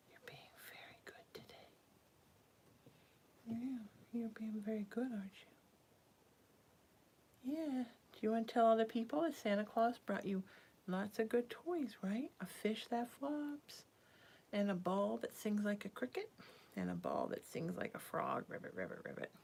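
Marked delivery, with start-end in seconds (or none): 0.1-1.7 barely audible, much softer whisper; faint whisper. Real, not acted. Repeating praise to pet, faint whisper. 3.5-7.5 soft whisper. Real, not acted. Praising the pet, soft whisper. 16.7-19.3 much lower croaking ribbit; frog croak. Retold or imitated. Describing the singing ball toy, frog croak.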